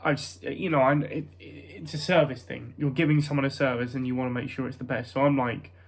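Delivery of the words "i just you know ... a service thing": usual speaking voice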